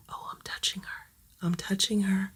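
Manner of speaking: hushed, breathless whisper; thrilled whispered aside; excited whisper. Real, not acted. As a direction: whisper so the kitten won't bolt. First time petting scared kitten.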